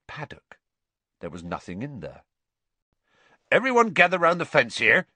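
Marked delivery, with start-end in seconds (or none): 3.5-5.1 loud, high, quick call. A narrator's reading. Hagrid gathering the class by the fence, calling out. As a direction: summon a group with raised voice. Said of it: "he called".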